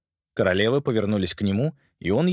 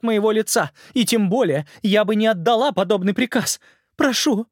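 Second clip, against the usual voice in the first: tearful vows; 10 semitones higher, 4 dB louder than usual. Acted. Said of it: "дрожащим голосом произнесла".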